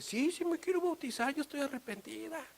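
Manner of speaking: put-on high childlike voice; a little girl pleading to be baptized. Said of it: "chiquita dice".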